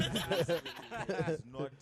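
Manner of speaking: loud, slow, laughing jab. Real, not acted. Storyteller mocking the old man to friends, laughing loudly. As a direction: drag it out loudly through real laughter.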